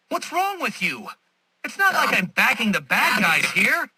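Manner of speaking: high-pitched, exasperated indignation; indignant protest. Acted. Villain defending himself to Batman, indignant. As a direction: protest as if wrongly accused.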